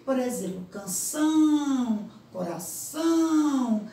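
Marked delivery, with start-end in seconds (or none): 0.7-2.1 "canção" with a long, loud nasal "ão"; som nasal destacado. Shown as a demonstration. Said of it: "para dar um som nasal". 2.3-3.8 "coração" with the final nasal "ão" drawn out; slow, held nasal ending on "coração"; som nasal destacado. Shown as a demonstration. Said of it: "para dar um som nasal".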